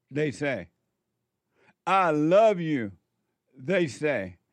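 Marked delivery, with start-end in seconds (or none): 1.9-2.9 mock mother's "I love you", slow, louder and higher; smothering mother. Mother imposing love on her grown child, slow mock pleading. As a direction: drag out the love like an imposing mama. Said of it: "An angry, emotional mother says".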